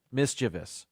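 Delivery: MISCHIEVOUS as MIS-chi-vus; three syllables in MISCHIEVOUS, stress up front; correct pronunciation. Shown as a demonstration. Host practising the newly learned word, MISCHIEVOUS said MIS-chi-vus. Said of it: "now that I know how to say it".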